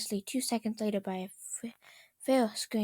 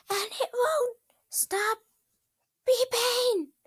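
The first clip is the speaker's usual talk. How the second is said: very high, breaking into a loud shriek; anguished wail; 14 semitones higher, 6 dB louder, slower than usual. Acted. A crying, whispery wail that bursts loud. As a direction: Twilight shrieking about the beeping toaster, high and anguished. The enraged princess wails.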